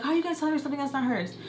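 high, rushed, indignant demand; mock scolding yell. Cousin challenges the girl over others' things, mock indignant yell. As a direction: imitate an irritated cousin telling a kid off. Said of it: "just, like, yelling at her".

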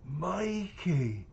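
high surprised shriek of a name; surprised screech. A narrator's reading. Old friend recognising Mikey, surprised screech. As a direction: cry the name out in shock. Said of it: "with a screech of total surprise".

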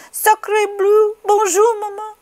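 put-on very high, loud baby voice; very high baby duck hello; newborn French duckling. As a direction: greet mama as a tiny French duck. Newborn duckling greets Jack.

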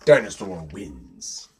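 low, drawn-out declaration; slow verdict. A narrator's reading. Narrator declares each round's winner.

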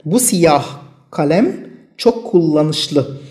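very slow, each word set apart for learners; slow dictation. Shown as a demonstration. Teacher reading the example sentence to students, very slow. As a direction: spell it out word by word, very slowly. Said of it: "مثال جملة".

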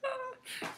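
tiny high squeal of a laugh; high giggle; high squeaky giggle. Real, not acted. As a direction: squeak with delight. Squeal after thanks.

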